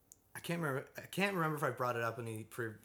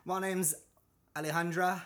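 higher, louder mock Australian introduction; badly faked Australian accent, pitched up; 6 semitones higher, 6 dB louder than usual. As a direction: imitate an Australian woman introducing herself. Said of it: "she has this Australian accent".

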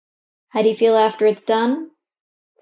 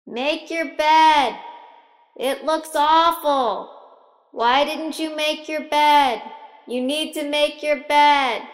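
higher, slow, mock scolding call; nagging parent voice; 5 semitones higher, slower than usual. Parent calling up from downstairs to the eight-year-old, scolding. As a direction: drag each order out like a fed-up parent. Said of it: "you hear that voice yelling at you".

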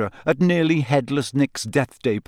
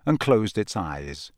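usual speaking voice

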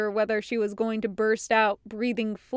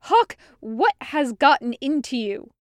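louder, demanding to know; stern captain demanding; 3 semitones higher, 5 dB louder than usual. A narrator's reading. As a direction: demand an answer from him. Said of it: "she demanded".

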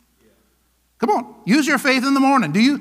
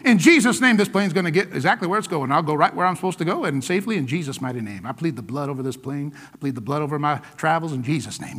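quieter, fast murmured prayer; 8 semitones lower, 4 dB quieter than usual. Acted. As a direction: pray over the plane under your breath. Praying over the plane.

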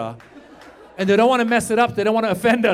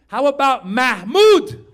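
slow, proud offer of the name; pitching a name; 5 semitones higher, 7 dB louder, slower than usual. Retold or imitated. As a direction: draw out Mahmoud hopefully. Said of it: "I go".

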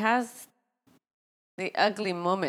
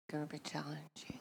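whispered aside; whispering; 4 semitones lower, 14 dB quieter than usual. Real, not acted. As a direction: mutter it under your breath. Aside to husband.

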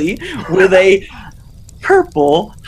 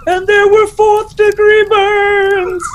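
spooky storyteller; 5 dB louder, slower than usual. Pretend. Mock horror story punchline.

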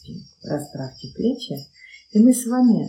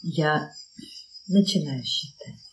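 ordinary talk

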